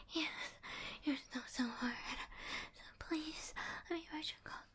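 urgent, breathy whisper close to the mic; breathless whisper. Acted. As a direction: whisper it, pleading and intimate. Intimate whispered roleplay.

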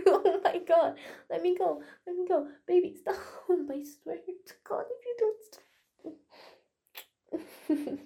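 high, loud squealing laughter through words; being tickled. Real, not acted. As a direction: laugh and squirm while pleading to stop. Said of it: "You can't just randomly start tickling me".

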